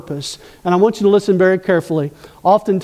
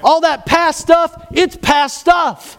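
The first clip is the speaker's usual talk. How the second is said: tender reassurance; 6 semitones higher, much slower than usual. Acted. God's reassurance voiced by preacher.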